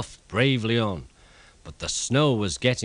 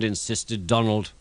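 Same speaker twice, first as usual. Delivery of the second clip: ordinary delivery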